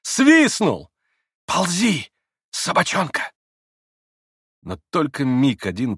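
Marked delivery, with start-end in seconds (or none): 0.0-0.9 high, loud bitter cry; sharp, overwrought outburst; cried out. A narrator's reading. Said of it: "прокричала она". 1.4-3.3 high-pitched contemptuous command; bitter scorn. A narrator's reading. Bitter self-mockery after summons.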